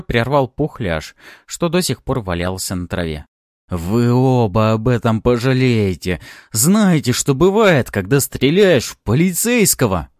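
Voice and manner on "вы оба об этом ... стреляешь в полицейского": loud, high, strained-up warning; angry threat. A narrator's reading. Wounded cop warning shooter, loud angry threat. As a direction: hurl the threat at both of them.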